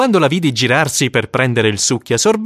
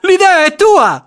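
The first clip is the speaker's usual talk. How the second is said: excited schoolboy; 7 dB louder than usual. Acted. Boys plotting excitedly.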